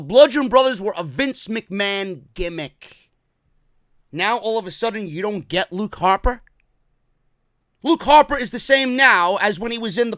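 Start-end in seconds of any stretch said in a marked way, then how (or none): none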